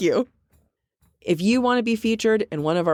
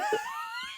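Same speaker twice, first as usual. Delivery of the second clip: high squealing burst of laughter; shrieking laugh; 12 dB quieter than usual. Real, not acted. Joke about conquering America.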